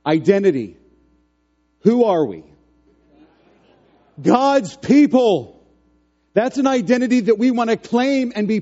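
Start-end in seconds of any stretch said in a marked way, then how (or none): 1.8-5.6 loud call, slow drawn-out answer; rally the room, answer loud; call and response. Real, not acted.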